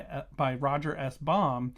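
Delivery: normal talking voice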